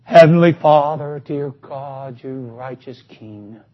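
mock the grand prayer put on for show; showy prayer. Preacher mocking a showy public prayer, low and slow. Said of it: "And it's all show".

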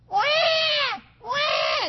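imitated baby cry. Preacher imitating a baby's huge cry, high wail. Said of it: "that baby goes like this".

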